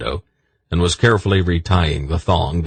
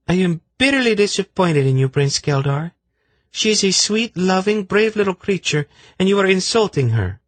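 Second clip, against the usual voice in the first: much higher, scolding voice; indignant high woman. A narrator's reading. Zenedra scolding Silk for ingratitude, indignant high voice. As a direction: defend the snake, indignant.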